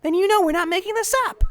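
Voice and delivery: loud, fast and eager; fast, loud plea to a doubting professor; urgent insistence. Acted. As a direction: push hard to be believed. Pleading for the professor's help.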